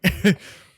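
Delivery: bright burst of laughter at own slip; a real giggle at a flubbed word; embarrassed laugh. Real, not acted. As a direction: laugh it off, caught out.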